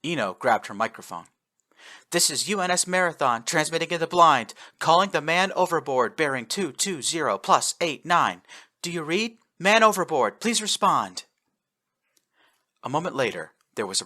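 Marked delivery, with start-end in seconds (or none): 2.1-11.2 raised formal radio call; radio hail. A narrator's reading. Officer hailing the lost man, raised radio call. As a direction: call clearly into the dark.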